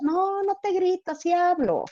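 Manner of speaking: aguda y mucho más suave, lenta; respuesta suave imitada. Retold or imitated. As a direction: se defiende bajito. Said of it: "si hablas fuerte". (quien habla fuerte se excusa en voz baja)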